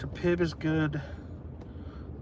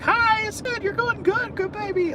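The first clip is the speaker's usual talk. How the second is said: very high, cheery cooing to a dog; squeaky-high happy pet talk; cheerful baby voice; 4 dB louder than usual. Shown as a demonstration. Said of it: "talk in a cheerful little baby mo-, uh, voice".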